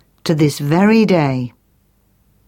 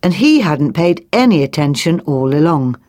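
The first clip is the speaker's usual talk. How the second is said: ordinary delivery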